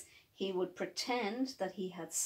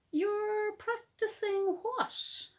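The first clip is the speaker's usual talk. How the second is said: much higher, slow puzzled question; puzzled daddy; 10 semitones higher, slower than usual. Acted. Daddy surprised at Hopscotch's answer. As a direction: stretch the disbelief. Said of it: "asked Daddy".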